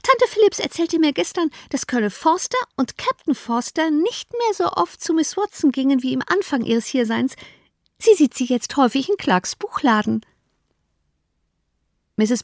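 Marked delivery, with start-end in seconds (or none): 0.0-10.2 put-on high, girlish gossiping voice; young Lydia. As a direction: chatter it like an eager teenage girl.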